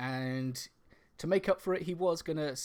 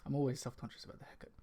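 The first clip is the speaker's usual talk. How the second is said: ordinary talk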